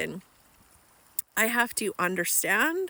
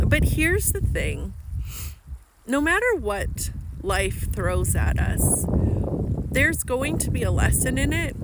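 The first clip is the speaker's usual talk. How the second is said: preaching; 2 semitones higher, 5 dB louder than usual. Acted. Delivers her life lesson.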